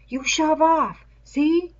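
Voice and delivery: half-hearted fierce warning; essayed fierceness. Acted. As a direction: try to sound tough but not quite manage it. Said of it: "essayed fierceness".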